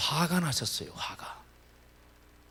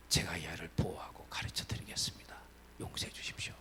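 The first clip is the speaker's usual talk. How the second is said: lower, hushed begging for pardon; whispered plea; 8 semitones lower, 13 dB quieter than usual. Retold or imitated. Teachers pleading with the missionary pastor, whispered begging. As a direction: beg in a breathless whisper.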